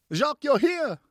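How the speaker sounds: high, loud happy greeting; near yell. A narrator's reading. Ellen spotting Jacques across the ballroom, almost yelling. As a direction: call out his name in delight. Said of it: "she almost yelled".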